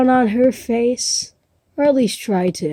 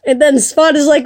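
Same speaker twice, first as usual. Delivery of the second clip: usual speaking voice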